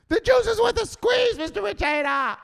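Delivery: very high, loud screech; desperate full-out yell. Acted. Beaten underling proving conviction to boss, desperate screech. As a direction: scream it like you mean it. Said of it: "Say it like you mean it".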